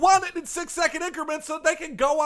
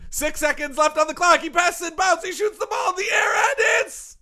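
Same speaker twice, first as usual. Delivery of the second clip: sports announcing; 4 semitones higher than usual. Acted.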